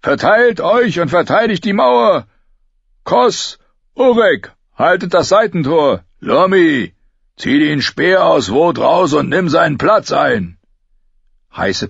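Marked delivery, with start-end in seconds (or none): 0.0-10.6 urgent raised battle commands; loud, higher-pitched commander rallying defenders; roaring orders. A narrator's reading. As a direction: shout orders across the courtyard. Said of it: "brüllte Joren".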